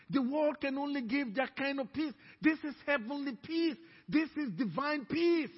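ordinary talk, nothing marked

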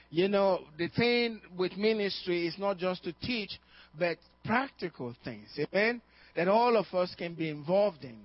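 rally the congregation with fervor; preaching. Acted. Pastor rallying his standing congregation, loud and rousing.

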